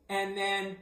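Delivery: usual speaking voice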